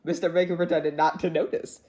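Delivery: usual speaking voice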